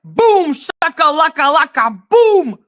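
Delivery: high-pitched mock victory chant; mocking triumph. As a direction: crow it like you made the winning point. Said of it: "He's like". (triomphe moqueur, voix très aiguë)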